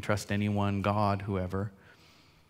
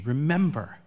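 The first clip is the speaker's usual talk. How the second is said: usual speaking voice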